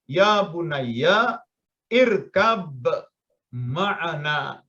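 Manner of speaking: "Ya bunayya, irkab, ma'ana" in separate slow pieces; word by word. Shown as a demonstration. Teacher reads "Ya bunayya / irkab / ma'ana" one by one. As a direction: stop after each word, bouncing the b of "irkab". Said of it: "Kalau Anda baca satu-satu".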